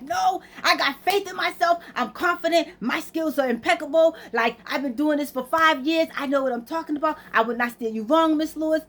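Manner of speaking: self-hyping, high-pitched confident pitch; imitating a support agent pumping himself up; Bobby. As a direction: sell your own skills with rising zeal. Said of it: "Bobby's hyping himself up".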